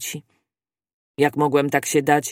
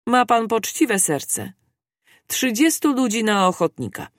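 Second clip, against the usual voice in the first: calling out; 5 semitones higher than usual. A narrator's reading. Captain praising Fogg, then to his soldiers, calling out higher. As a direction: call for volunteers in a raised pitch. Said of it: "zawołał, zwracając się do swych żołnierzy".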